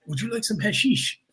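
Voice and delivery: hushed mock-French invitation; French host. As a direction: offer it discreetly in a French accent. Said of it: "then Stefan goes".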